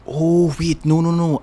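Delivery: high, loud self-correction; sudden realization. Real, not acted. Streamer suddenly remembers an article they read, surprised. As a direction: cut in as the memory hits, pitch jumping up.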